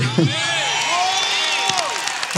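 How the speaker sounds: joyful laugh burst. Real, not acted.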